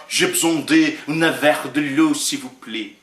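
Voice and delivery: plain spoken French request, trailing off; French phrase. Shown as a demonstration. Preacher jokes a Brazilian won't speak French, in French. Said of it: "Não, isso é francês".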